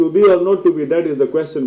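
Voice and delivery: loud, high, rattled off without pauses; unsellable reading. Shown as a demonstration. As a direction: rush the line through with no drama. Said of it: "بولیں گے تو نہیں بکے گا".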